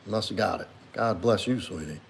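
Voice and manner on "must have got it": ordinary talk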